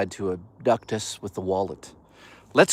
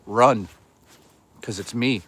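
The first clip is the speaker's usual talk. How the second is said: ordinary talk, nothing marked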